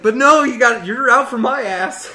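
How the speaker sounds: playful exaggerated grievance; mock indignant. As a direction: complain about being hunted. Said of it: "It's like".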